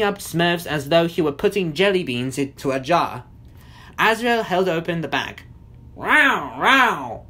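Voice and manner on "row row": imitated drawn-out cat yowl; two yowling meows; cat meowing. A narrator's reading. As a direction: meow like a villain's cat.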